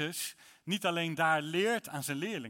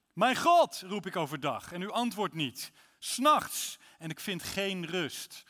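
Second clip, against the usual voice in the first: anguished psalm cry; 3 semitones higher, 6 dB louder than usual. Acted. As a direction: let the cry swell, then ease off. Reads psalm 22 aloud. (opbouwende, wanhopige uitroep tot God)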